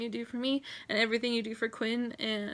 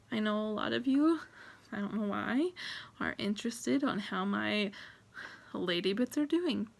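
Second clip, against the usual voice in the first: normal talking voice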